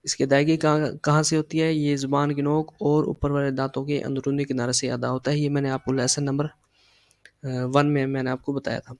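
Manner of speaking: normal talking voice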